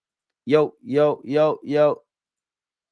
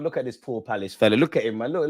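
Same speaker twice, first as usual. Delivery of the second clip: normal talking voice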